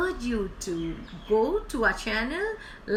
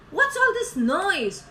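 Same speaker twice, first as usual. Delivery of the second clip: tag as woken chimp; 7 semitones higher, 5 dB louder, slower than usual. Acted. Chimp woken by hiccups complains.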